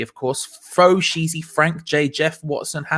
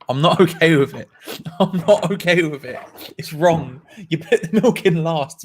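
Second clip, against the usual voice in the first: giggling through fake indignation; laughing mock outrage. Real, not acted. Host laughing at milk-first tea drinkers. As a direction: laugh while protesting.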